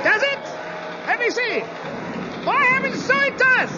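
high excited voice. Acted. Excited at window.

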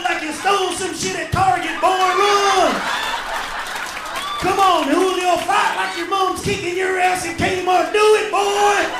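yelling loud and high; a hyped spectator screaming encouragement; fight-crowd shout. Retold or imitated. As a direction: egg on a fighter at full yell.

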